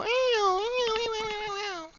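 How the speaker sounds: very high mock whimper of ew; little girl crying. Mocking how little girls cry, in a squeaky high whimper. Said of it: "Little girls, they go".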